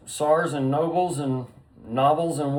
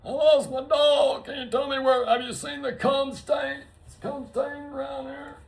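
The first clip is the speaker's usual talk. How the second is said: high mock dog owner; 12 semitones higher than usual. Pretend dog owner asking around.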